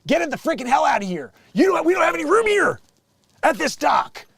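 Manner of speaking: high, loud, scolding outburst; mock indignant yell. Dock employee ordering yacht owner away, indignant. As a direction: act out an irate dock worker, voice climbing.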